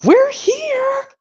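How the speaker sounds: very high squeaky cartoon voice; cartoon character voice. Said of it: "that goes like this".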